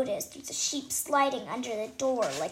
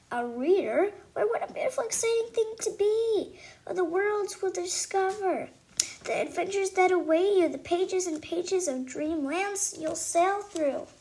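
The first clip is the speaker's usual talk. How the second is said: high, dreamy, slowing at the end; dreamy rhapsody; 7 semitones higher, 4 dB louder than usual. Acted. Fairy godmother marvelling at reading, high and dreamy. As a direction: paint a grand picture of reading.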